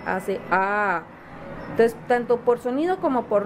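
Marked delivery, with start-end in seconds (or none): 0.5-1.0 "A" held as a long open vowel; drawn-out phonics "A"; letter-sound demo. Shown as a demonstration. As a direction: sustain the sound of "A". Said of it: "¿cómo hace esta letra?".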